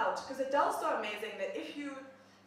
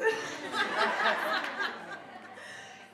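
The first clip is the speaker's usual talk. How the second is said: high laugh; 9 semitones higher than usual. Real, not acted. Laughing after greeting aside.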